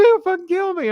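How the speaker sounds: loud, very high mock-terrified squeal; scared to death. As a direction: imitate a big man panicking for his life. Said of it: "scared to fucking death".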